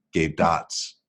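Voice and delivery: usual speaking voice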